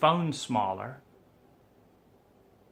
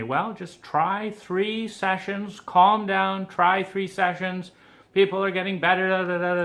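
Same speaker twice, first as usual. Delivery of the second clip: mock pushy therapist; 6 semitones higher, 7 dB louder than usual. Mimicking therapist's soothing push.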